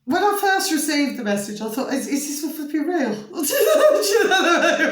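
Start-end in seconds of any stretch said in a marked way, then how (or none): 1.9-4.9 high, loud giggling; cracking up while recalling; laughing. Real, not acted.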